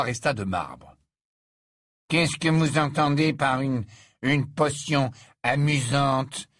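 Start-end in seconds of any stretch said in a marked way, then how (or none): 2.1-6.5 louder, higher, dragging the last words; irritated. Acted. Malfoy challenges Slughorn's task, irritated and louder. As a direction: query it with irritation, slowing at the end. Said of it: "demanda-t-il irrité".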